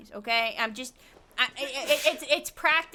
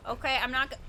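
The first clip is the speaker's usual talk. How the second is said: normal talking voice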